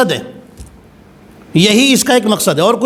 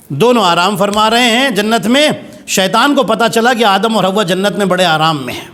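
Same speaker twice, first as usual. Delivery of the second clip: ordinary talk, nothing marked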